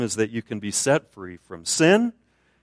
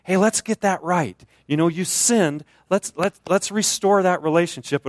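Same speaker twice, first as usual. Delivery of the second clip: lightly voiced gentle coaxing, a bit higher; Holy Spirit coaxing; 7 semitones higher, 3 dB louder than usual. The Spirit urging a busy believer, lightly coaxing. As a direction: coax them back like a friend. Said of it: "the Holy Spirit's saying".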